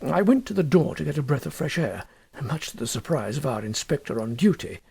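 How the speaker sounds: usual speaking voice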